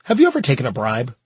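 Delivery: ordinary delivery, nothing marked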